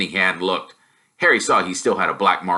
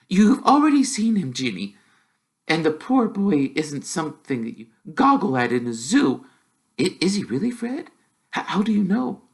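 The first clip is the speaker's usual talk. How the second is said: a lightly voiced gentle mum; half-chiding her daughter, then curious; mum's voice, a touch higher; mother mildly scolding; 10 semitones higher than usual.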